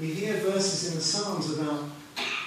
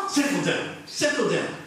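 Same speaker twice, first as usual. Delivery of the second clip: mock hushing a dog; 3 semitones higher than usual. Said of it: "quieten down a yapping dog".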